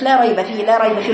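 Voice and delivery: "لا ريب فيه" rattled off with a short "لا"; madd cut short. Retold or imitated. Teacher imitating a student's recitation, madd missing. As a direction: give "لا" no length at all. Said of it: "لا تسمعي المد".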